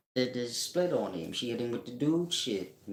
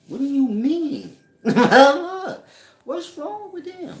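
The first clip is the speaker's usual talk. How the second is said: laughing; 12 dB louder than usual. Real, not acted. Laughing at the caller's question.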